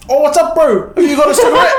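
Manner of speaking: high-pitched mock street greeting. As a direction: put on a high, jokey bro voice. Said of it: "a lot of people just assume that".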